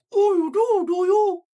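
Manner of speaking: very high, loud, pursed-lip squeak; tiny-mouthed falsetto; puckered-mouth frog. Acted. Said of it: "elevate the pitch of my voice".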